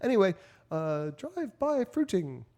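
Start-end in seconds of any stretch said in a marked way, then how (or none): 1.2-2.4 high, drawn-out mock British lady; playful British accent impression; Mrs. Doubtfire. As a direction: put on a silly high British matron voice. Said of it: "I heard Missus Doubtfire".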